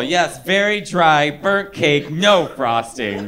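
stretched, deadpan agreement; slow agreeing drawl. Retold or imitated. Quoting Nicole agreeing slowly with the friend's joke. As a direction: drag out each phrase, dry and slow.

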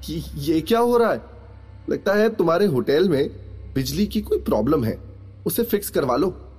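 put-on high girl's voice, dismissive; amused, cocky girl. A narrator's reading. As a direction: point out the fault in a high, breezy voice. Girl mocking hotel's flickering lights.

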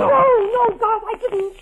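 high, loud frantic denial; panicked cry of innocence to her husband; desperate plea. Acted. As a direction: beg him to believe you. Pleading against betrayal accusation.

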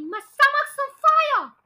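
high, loud cry of alarm; shouted. A narrator's reading. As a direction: shout it out in high-pitched panic. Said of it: "she shouted suddenly".

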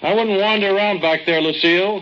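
calling out. Acted. Calling into the cave after Lucille.

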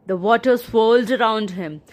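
ordinary delivery

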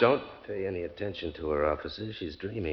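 low, soft aside to an officer; hushed, placating husband; smooth reassurance. Acted. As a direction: quietly dismiss the worry. Husband placating policeman about his wife.

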